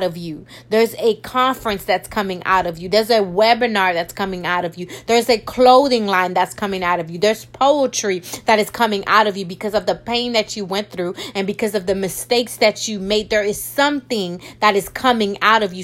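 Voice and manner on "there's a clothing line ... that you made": ordinary talk, nothing marked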